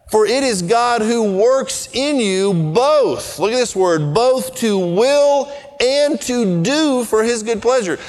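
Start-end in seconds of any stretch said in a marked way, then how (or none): 0.1-3.4 slow, weighted verse reading; solemn slow Bible quote; scripture reading. Acted. 4.1-8.0 slow, weighted verse reading; solemn slow Bible quote; scripture reading. Acted. Finishing the verse slowly.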